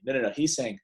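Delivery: ordinary delivery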